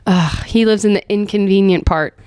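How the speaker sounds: normal talking voice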